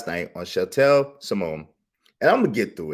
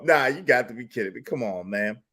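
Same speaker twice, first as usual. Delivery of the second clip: usual speaking voice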